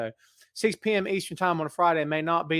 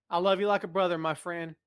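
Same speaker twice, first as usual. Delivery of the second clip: ordinary talk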